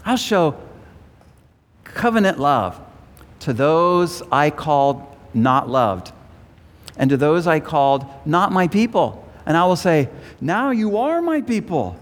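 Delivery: declaimed verse with high emphatic peaks; scripture reading. A narrator's reading. Preacher reading God's promise to His people, declaimed scripture reading. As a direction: lift the quoted replies high and emphatic.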